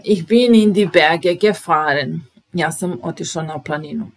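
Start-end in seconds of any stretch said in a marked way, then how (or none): 0.0-2.3 loud, clear Ich bin in die Berge gefahren; loud model sentence. Shown as a demonstration. Teacher introduces fahren with a loud model sentence. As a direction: announce it to the class loudly. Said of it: "Sad idemo na Fahren".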